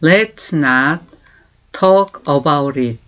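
very slow, each word laid out for learners; slow model phrase. Shown as a demonstration. Teacher slowly modelling for learners. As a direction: say it slowly for students to repeat. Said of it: "다시 한번".

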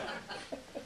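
quick unvoiced chuckle; a soft laugh under the breath; short breathy laugh. Laugh after his reply.

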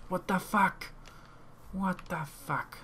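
can't believe what keeps turning up; exasperated disbelief. Real, not acted. Player finding sheep instead of pigs, exasperated.

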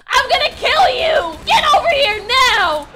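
loud, high-pitched threat; angry shout. Acted. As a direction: yell it as you charge after the attacker. Threatens the shooter in game.

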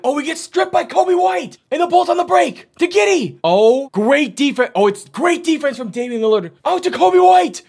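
high, loud, shouted fast-break call; frantic play-by-play. Real, not acted. Commentator calling a steal and break, frantic play-by-play. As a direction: keep shouting as the ball moves.

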